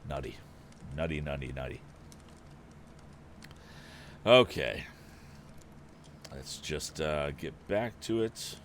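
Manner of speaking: plain reading voice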